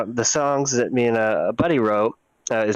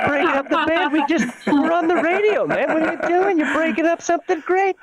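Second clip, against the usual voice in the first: mock outraged rant. Ranting about [name] breaking band.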